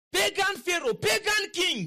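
high, forceful preaching cry; preacher's exclamation. Real, not acted. Preacher exclaiming over God speaking to a pagan ruler, forceful and high. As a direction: hurl it out high, in preaching style.

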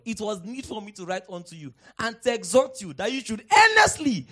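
loud, high preacher's cry; full-force preaching. Real, not acted. Preacher urging the church to contend for the faith, shouting. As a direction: shout the charge to contend, building up.

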